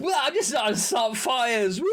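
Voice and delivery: high laughing cry; laughing cackle. Pretend. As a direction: cackle it out gleefully. Gleeful mock arsonist shout.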